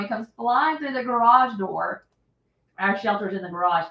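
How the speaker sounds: ordinary talk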